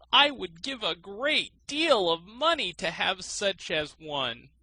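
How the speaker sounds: lightly voiced high old miser; an eager old man wanting the bird. A narrator's reading. Miser longing for the thrush, lightly voiced high. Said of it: "said the miser".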